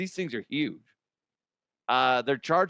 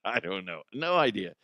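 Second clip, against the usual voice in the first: normal talking voice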